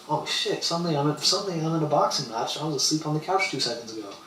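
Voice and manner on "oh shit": higher, caught off guard; startled. Retold or imitated. Narrator thrown into a boxing match, startled blurt. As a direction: blurt it out in sudden alarm.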